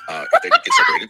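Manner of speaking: laughing. Real, not acted. Laughs while telling the story.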